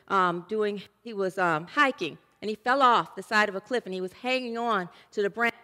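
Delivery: ordinary talk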